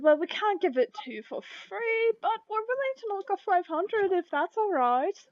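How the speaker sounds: high-pitched, sing-song bargaining voice; accommodating shopkeepers. Acted. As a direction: offer the discount in a high shopkeeper voice. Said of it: "they nod and they said".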